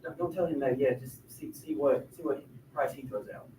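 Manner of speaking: hushed, low conspiratorial advice; whispered aside. Acted. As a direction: whisper it so the seller can't hear. Adviser's secret aside during negotiation.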